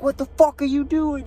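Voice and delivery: high, furious mock yell; yelling runner. Jogger imitated yelling at the chasing driver. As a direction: bellow at the honking driver, imitating. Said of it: "he starts yelling at him".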